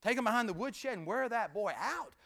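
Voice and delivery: ordinary talk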